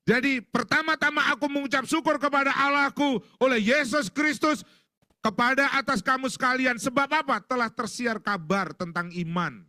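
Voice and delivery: high, loud, declaimed verse; loud scripture reading. Acted. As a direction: read the verse out with full projection. Reads out Paul's letter.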